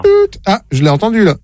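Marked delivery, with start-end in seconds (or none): 0.0-0.4 high keypad beep; beep imitation. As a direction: squeak like a phone key. Prank caller imitating keypad beep. (bip de touche)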